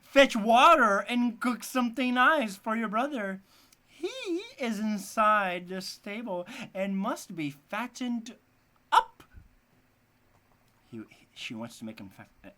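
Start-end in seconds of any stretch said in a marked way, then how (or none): 0.0-9.2 witch crying out. A narrator's reading. Witch waking Gretel and ordering her to cook, loud high yell. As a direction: bark orders like a wicked witch.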